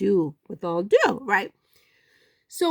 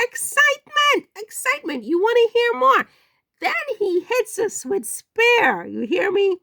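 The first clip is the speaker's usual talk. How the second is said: hyped excitement; 11 semitones higher than usual. Real, not acted. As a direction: build it like a gossip hype reel. Hyping each new revelation.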